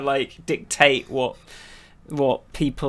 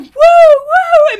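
much higher and louder, silly party whoops; hammed-up woo like an avatar floating around; mock whooping; 14 dB louder than usual. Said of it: "Why are you going".

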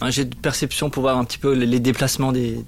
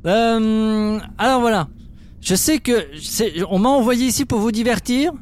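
higher showman voice; entertainer voice; 8 semitones higher, slower than usual. Acted. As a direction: play the jolly entertainer. Entertainer role before guards. (voix d'amuseur public)

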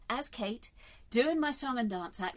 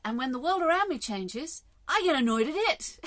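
louder, exasperated; 5 semitones higher, 8 dB louder than usual. Real, not acted. Laughing self-mockery, loud.

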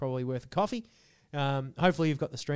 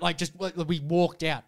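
ordinary talk, nothing marked

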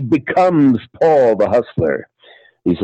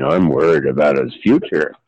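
low-pitched dad voice; deep, worried father; 9 semitones lower than usual. Acted. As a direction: drop low for the concerned father. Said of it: "his father is saying".

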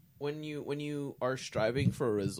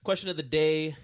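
announcer; 3 semitones higher, 8 dB louder than usual. Acted. Announcing segment again.

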